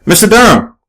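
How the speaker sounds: high, eager call of his name; exclaiming. A narrator's reading. Reporter hailing the boxer from the car, exclaiming. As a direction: call out to catch his attention. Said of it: "she exclaims".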